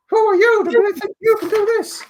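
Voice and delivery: very high, loud cartoon squeak; comic cartoon mouse scolding; Mickey Mouse. Shown as a demonstration. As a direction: squeal it out in a silly falsetto. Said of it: "Change the voice to Mickey Mouse's voice".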